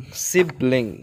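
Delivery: ordinary talk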